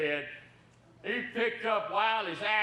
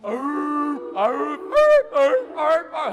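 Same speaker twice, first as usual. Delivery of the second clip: loud, high barking yelps; dogs yelping; 7 semitones higher, 8 dB louder, much slower than usual. Retold or imitated. Storyteller yelps like the hunting dogs. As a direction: bark and yelp like hound dogs far off. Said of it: "here we go with the dogs yelping".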